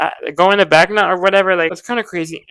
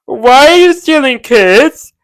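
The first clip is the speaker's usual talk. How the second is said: loud, higher and slow outrage; outraged; 7 semitones higher, 9 dB louder, slower than usual. Retold or imitated. Vlogger scolds Vecna for stealing kids, loud and slow. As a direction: shout it out slowly, scandalised.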